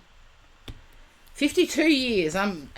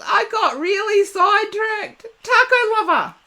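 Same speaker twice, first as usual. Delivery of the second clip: excited call-out; 10 semitones higher, 6 dB louder than usual. Real, not acted. Laughing at herself, calling to viewer.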